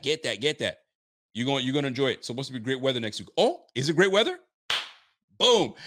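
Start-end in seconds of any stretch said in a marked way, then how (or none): 3.4-5.7 higher, louder mock amazement; mock surprise. Real, not acted. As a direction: act thrilled at the forecast.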